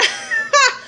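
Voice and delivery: sharp high burst of disbelieving laughter; incredulous laugh. Real, not acted. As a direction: laugh in disbelief at how crazy it was. Laughs at the absurd encounter.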